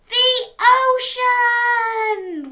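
high, long, loud whoop; joyful whoop. Acted. Dog sights the sea at last, whooping. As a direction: stretch it into a whooping shout. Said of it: "whooped Dog".

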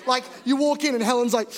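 usual speaking voice

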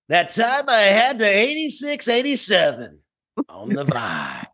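high, hammed-up top forty DJ; Al Farb. Shown as a demonstration. Host imitates former producer back-selling a song, hammed-up DJ voice. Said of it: "let me do it like Al Farb".

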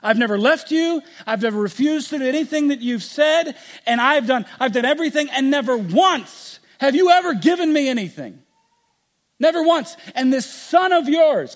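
elder son accusing father, furious; angry son. Acted. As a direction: throw every grievance at the father. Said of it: "He's angry".

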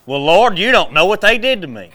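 loud, high protest, wounded pride; whiny complaint to God. Retold or imitated. Churchgoer complaining to the Lord, loud and whiny. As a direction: plead your hurt loudly. Mocking a grudge-holder talking to God.

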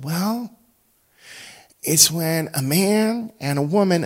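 a hint of a five-year-old explaining; child's voice. Dad quoting his small daughter's answer, lightly childlike.

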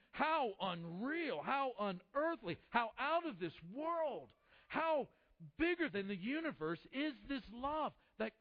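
slow, drawn-out exclamations of awe; emphatic wonder. Acted. Preacher marvels at God's love, in slow, emphatic wonder.

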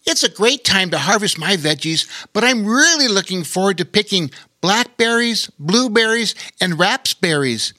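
put-on high, eager young otter; chirpy little otter listing berries; squeaky otter voice. A narrator's reading. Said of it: "said the young otter".

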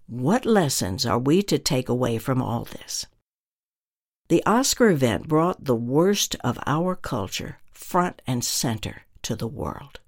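ordinary talk, nothing marked

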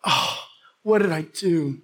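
low, subdued self-reproach; retold dismay. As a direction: sink into mild regret. Retold dismay after being scolded.

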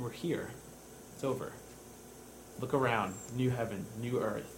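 soft, low, unhurried comfort; quietly soothe, pausing between short phrases; tender reassurance. Acted. God wipes tears from the newcomer, tender reassurance.

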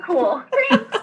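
high, laughing awkward thanks; mock thanks. Patient answers doctor's tonsil joke, high mock thanks. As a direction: pitch it up as a half-laughed polite reply.

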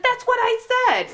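high, loud grandma retort; exasperated grandma. Retold or imitated. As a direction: insist indignantly as a misunderstood grandma. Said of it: "She's like".

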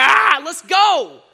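slow, loud, high battle cry; imagined listener fired up by a lion simile; pumped up. Pretend. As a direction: charge in like a lion. Acting lion courage.

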